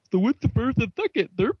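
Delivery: high-pitched mock dimwit voice; retold goofy character. Retelling a show scene to co-hosts, goofy character voice. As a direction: put on a silly high voice, garbling the words. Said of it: "He's like".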